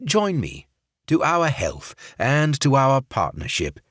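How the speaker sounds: fake English accent. Barrett toasting the partnership in fake English accent. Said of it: "in his fake English accent".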